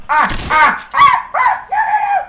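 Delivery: shrill panicked screams; panicked screaming. Real, not acted. Panicking during tricky level.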